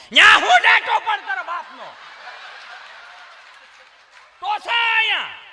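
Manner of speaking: put-on high, loud Kathiawadi yell at the kid; rustic village shout. Village parent shouting rough dialect at child. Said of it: "તળપદી કાઠિયાવાડી દેશી ભાષામાં".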